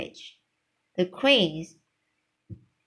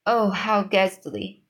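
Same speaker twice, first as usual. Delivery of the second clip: ordinary delivery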